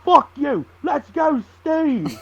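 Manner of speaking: high-pitched mock drunk jeer; high mocking fan chant, breaking into a laugh; drunk fan heckling. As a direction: sneer it at him like a drunk heckler. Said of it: "And he goes".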